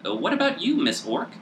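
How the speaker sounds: teasing squeak of a small gnome; squeaky gnome voice. Acted. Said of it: "looks up at her and says".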